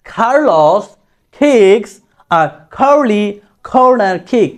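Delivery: "কার্লোস kicks" slowly, every K puffed. Shown as a demonstration. Teacher modelling British K, slow aspirated words. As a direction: aspirate each K in "curly corner kick". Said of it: "খর মতো হলো".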